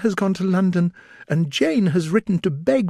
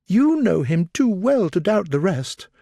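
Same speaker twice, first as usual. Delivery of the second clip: much higher, tearful distress; distressed after tears; 9 semitones higher than usual. A narrator's reading. Elizabeth tearfully telling Darcy of Lydia's elopement, distressed. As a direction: pour out the bad news, near tears. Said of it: "At length she spoke again".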